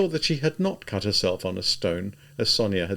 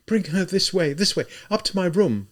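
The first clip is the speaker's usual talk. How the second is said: higher pleading voice; begging; 9 semitones higher than usual. A narrator's reading. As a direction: beg them to bring her home. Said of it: "Sonia begged".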